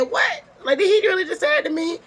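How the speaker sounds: high, fast, incredulous; can't believe he just said that; stunned disbelief. Retold or imitated. Wanda stunned at Marcel's claim.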